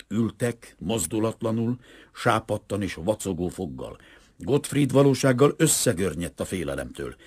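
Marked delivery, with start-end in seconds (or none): none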